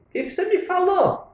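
high feigned-innocent question; mock puzzlement. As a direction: play dumb innocently. Feigned ignorance to mother-in-law.

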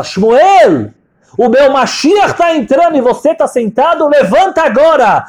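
high, loud divine command; commanding rebuke. Retold or imitated. God rebuking seated Shmuel as the Mashiach enters, loud high command. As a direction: order him up, pitched high and loud.

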